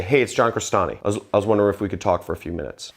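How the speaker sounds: assured greeting, tune sloping down; matter-of-fact caller, falling phrase ends; authoritative downward slope. Shown as a demonstration. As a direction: introduce yourself with authority, pitch falling. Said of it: "It seems authoritative".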